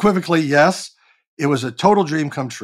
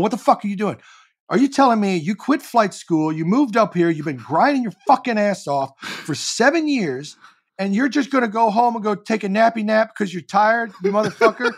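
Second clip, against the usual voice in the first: higher-pitched, heated self-scolding rant; yelling at myself; 6 semitones higher than usual. Retold or imitated. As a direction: tear into yourself like you're fed up. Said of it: "yelling at myself".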